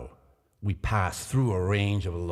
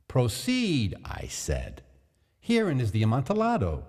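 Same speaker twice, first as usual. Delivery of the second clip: higher, brisk invitation; inviting; 6 semitones higher than usual. A narrator's reading. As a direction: lure him onward.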